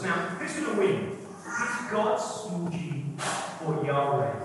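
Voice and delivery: normal talking voice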